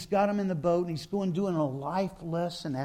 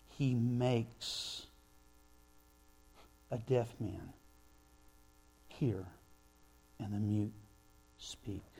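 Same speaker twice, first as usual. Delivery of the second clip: hushed awe; 7 semitones lower, 7 dB quieter, slower than usual. Retold or imitated. Crowd quote read softly.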